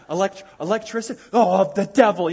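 mock alarm. Mocking paranoid churchgoer.

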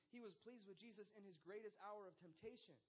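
normal talking voice

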